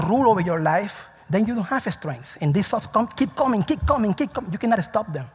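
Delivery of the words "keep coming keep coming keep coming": high, insistent run of repeats; higher-pitched, unstoppable repeated beat; relentless repetition. Acted. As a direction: pile the phrases up one after another. Acting out relentless incoming thoughts.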